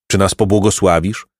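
plain reading voice

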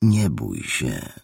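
slow, low and soft: don't be afraid; calm a scared boy slowly; a stranger gently calming a frightened boy, slow and soft; gentle reassurance. A narrator's reading.

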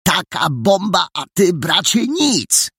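high, indignant scolding; exasperated high-pitched reproach; reproachful. A narrator's reading. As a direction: chide a friend for not caring. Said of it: "spojrzał na niego karcąco".

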